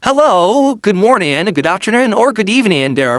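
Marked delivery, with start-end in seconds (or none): none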